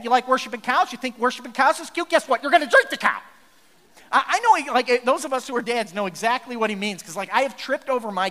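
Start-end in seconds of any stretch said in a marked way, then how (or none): 0.0-3.2 louder, rising mock lecture; mock dad scolding. A dad scolding his kids over the cow, loudly. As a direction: scold like a fed-up father.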